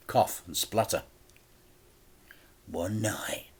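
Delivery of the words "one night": menacing whisper. A narrator's reading. Crime boss whispers to the debtor, menacing. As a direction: hiss it with quiet menace.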